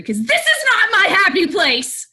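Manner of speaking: high, loud snapping shout; angry outburst. Acted. Teacher losing it with rowdy kids, angry outburst. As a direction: explode at the end of your rope. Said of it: "now you're really making me angry".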